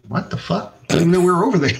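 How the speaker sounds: mock-shocked retelling of his own reaction; stunned disbelief. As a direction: blurt it out in shock, voice jumping up. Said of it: "I was like".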